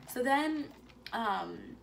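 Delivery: ordinary talk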